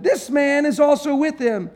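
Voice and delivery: high, pointed accusation; accusing servant girl. Acted. Servant girl singling Peter out, high and accusing. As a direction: point him out to the crowd.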